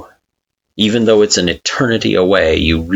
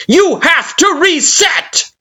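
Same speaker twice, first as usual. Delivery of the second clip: commanding shout. Acted. Kara commanding you to reset, furious shout. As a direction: order it with menace.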